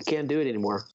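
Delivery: normal talking voice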